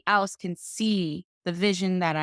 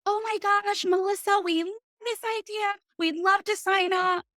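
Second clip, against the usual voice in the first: much higher, hammed-up delight; gushing mock excitement. Imitating clients gushing over the idea once her professor backed it, much higher. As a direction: squeal like a suddenly thrilled client.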